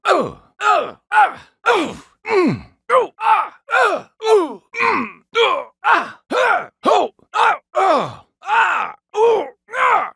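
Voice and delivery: high, sharp hurt grunts and gasps; pain grunts. Acted. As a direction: grunt as each blow lands.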